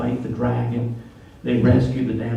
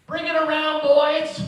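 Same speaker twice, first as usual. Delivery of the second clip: 高声喊出窗外，音调升高; yelling out window; 14 semitones higher, 5 dB louder, slower than usual. Retold or imitated. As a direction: shout high and loud out the window. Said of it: "leans out the window and yells".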